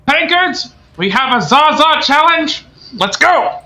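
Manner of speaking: high, loud rallying shout; rowdy tavern holler; calling to the barkeep. Acted. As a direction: announce the challenge to the whole tavern. Looks over to the barkeep and goes.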